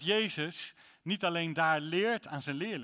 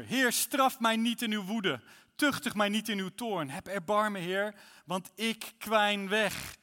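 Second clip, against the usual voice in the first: higher, louder anguished reading, slowing on the pleas; pleading psalm; 5 semitones higher, 5 dB louder than usual. Acted. Reads psalm six as lament. (smekend, luid voorgelezen klaagpsalm)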